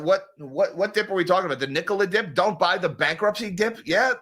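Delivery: ordinary talk, nothing marked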